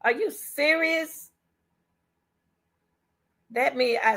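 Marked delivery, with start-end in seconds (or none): 0.0-1.3 high, slow incredulous question; aghast, asking if it's true; high astonished question; shocked disbelief. Real, not acted. Disbelief at news.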